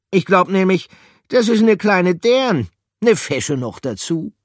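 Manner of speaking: very high, loud cheeky voice; flirty appreciation of the pretty mouse; high, loud cheeky admirer; admiring character. Acted.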